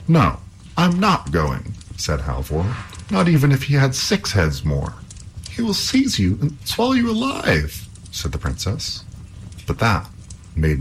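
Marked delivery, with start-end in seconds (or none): 0.1-1.8 high, slow, stubborn refusal; defiant Halvor. A narrator's reading. Halvor refuses to leave the castle, defiant. 3.1-4.9 high-pitched, stubborn storybook hero; defiant Halvor. A narrator's reading. Halvor tells princess he will stay, defiant. 5.5-7.9 very high storybook princess voice; alarmed princess. A narrator's reading. Princess warns Halvor about the troll, alarmed.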